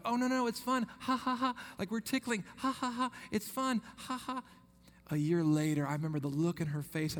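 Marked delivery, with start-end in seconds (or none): 0.0-4.4 high, playful fake laughter to calm a scared child; high-pitched forced ha ha ha to soothe a frightened girl; mock reassuring laugh. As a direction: laugh it off brightly, coaxing her that tickling is fun. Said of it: "And I was like".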